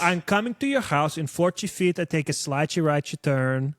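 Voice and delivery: imitating a mom's thick Brazilian accent. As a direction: put on a sing-song Brazilian-accented English. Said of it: "Did your mom have a thick accent".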